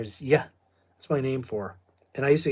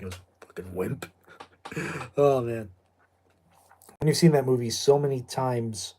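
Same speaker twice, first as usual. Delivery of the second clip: normal talking voice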